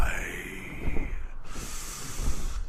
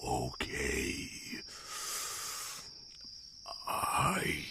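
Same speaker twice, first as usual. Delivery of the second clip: hurt, weakened dragon; 3 semitones higher, 10 dB quieter than usual. Acted. Injured dragon urging its human to run, weak and pleading. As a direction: plead faintly, barely able to speak.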